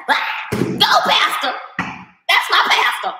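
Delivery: mock-excited, slow and airy cheering; airy imitation of a churchgoer cheering her pastor. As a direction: cheer on the pastor like a starstruck fan. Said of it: "they're like".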